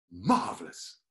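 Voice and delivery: low, drawn-out posh reply; posh Molly. Retold or imitated. [name] imitates Molly's grand reply, low and drawn out. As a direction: savour the word grandly. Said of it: "she'd go".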